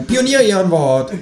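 high, slow kangaroo voice; solemn mock oath. Kangaroo swearing to its flatmate, slow mock-solemn high voice.

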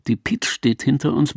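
ordinary delivery, nothing marked

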